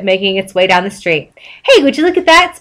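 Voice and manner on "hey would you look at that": exclaiming. A narrator's reading. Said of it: "SpongeBob exclaimed".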